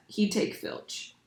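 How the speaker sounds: soft, slow, breathy hush; hushed aside. A narrator's reading. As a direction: drop to a grim murmur. Narration drops to a hush.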